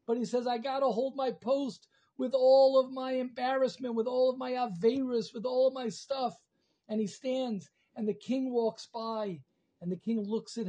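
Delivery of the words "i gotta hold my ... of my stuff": high, loud, determined plea; impassioned resolve. Acted. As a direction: push through the shame, voice raised. Embarrassed man resolves to stand post.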